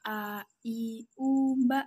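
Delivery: teacher's recitation demo; hijaiyah letter drill. Shown as a demonstration. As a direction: chant "a, i, u, ba" syllable by syllable. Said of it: "Coba perhatikan".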